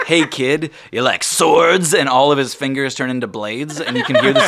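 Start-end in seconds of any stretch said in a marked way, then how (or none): 0.1-1.9 high, loud, slow genie tease. Acted. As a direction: tempt the kid like a showy genie.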